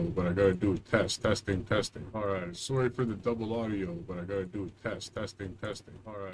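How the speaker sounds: faint fading echo; delayed repeat from another speaker; echo from second device. Real, not acted.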